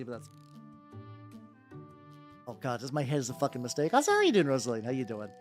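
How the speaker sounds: ordinary talk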